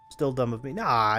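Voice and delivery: ordinary talk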